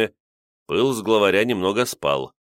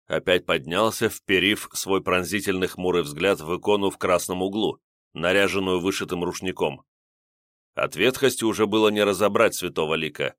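usual speaking voice

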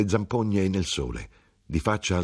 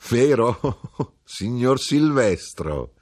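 high teasing question with a laugh before signor Silvestro; 5 semitones higher, 4 dB louder, much slower than usual. A narrator's reading. Widow to the son, laughing and teasing. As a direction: tease him with a giggle. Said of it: "ridendo disse".

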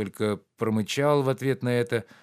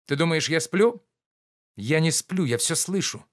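insistent; 6 semitones higher, 4 dB louder than usual. Acted. Sleepy master insists.

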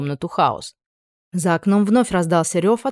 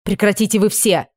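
exploding; 3 semitones higher than usual. A narrator's reading. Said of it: "взорвалась Анна".

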